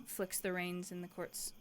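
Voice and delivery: ordinary talk, nothing marked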